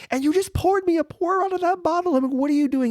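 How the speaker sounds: very high-pitched retold disbelief; astonished protest. Customer protesting to the owner who poured it, high astonished retelling. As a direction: protest in a comically high, incredulous voice.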